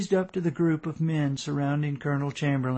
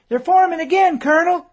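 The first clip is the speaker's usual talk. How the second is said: high, loud alarm call; 8 dB louder than usual. A narrator's reading. Young soldier in a tree warns the colonel, loud and high. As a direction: shout the warning down from the treetop.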